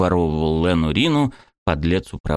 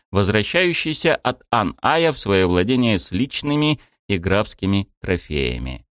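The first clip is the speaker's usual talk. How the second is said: usual speaking voice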